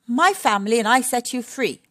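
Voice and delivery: a mild scolding; Missus Warthog. Acted.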